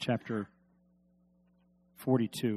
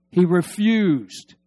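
slow, loud, drawn-out answer; slow emphatic; 5 semitones higher, 9 dB louder, much slower than usual. Shown as a demonstration. Preacher answering his own question, slow and loud. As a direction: lean on it slowly. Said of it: "but what did he do?".

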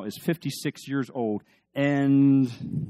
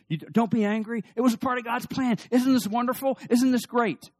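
high, eager reassurance; cheerful brother gushing to his brothers; excited Joseph; 12 semitones higher, 5 dB louder than usual. Retold or imitated. Joseph cheerfully telling his brothers not to be angry, high and eager.

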